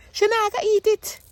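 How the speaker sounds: high-pitched imitation of mom's Jamaican accent; mock Jamaican mom refusing okra. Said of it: "that's her in her Jamaican accent".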